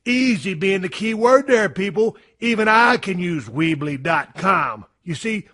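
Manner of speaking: upbeat sponsor pitch; ad read. Acted. Host reading a sponsor spot to his audience, upbeat and salesy. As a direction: sell the website builder to the listeners.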